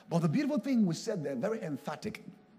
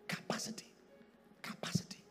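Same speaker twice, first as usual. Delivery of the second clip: very soft, voiceless repetition; hushed emphasis; 11 dB quieter than usual. Real, not acted. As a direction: drop to a whisper to let it sink in. Repeats key word in a hush.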